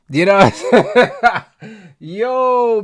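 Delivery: loud laugh breaking through; real laughter. Real, not acted. Laughing after "peak.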